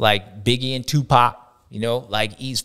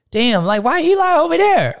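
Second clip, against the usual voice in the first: baffled exclamation; 12 semitones higher, 5 dB louder than usual. Retold or imitated. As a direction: pitch jumps way up, louder, incredulous. Retold riders' baffled reaction.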